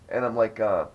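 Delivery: normal talking voice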